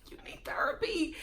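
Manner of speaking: hushed, judging whisper; whispered aside. Retold or imitated. Host reacting to a man's tearful confessional, whispered.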